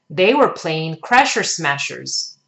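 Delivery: ordinary delivery, nothing marked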